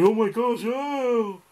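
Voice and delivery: high-pitched mocking gasp of horror; exaggerated high "oh my gosh"; mock appalled onlooker. Mimics people reacting to fat guy.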